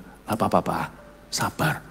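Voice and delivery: soft, low soothing words; gently calm him down, quietly; calming father. Retold or imitated. Son holding his father's hand, soft and soothing. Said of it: "Saya pegang tangan papa saya, berkata".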